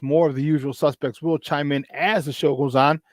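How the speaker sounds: ordinary delivery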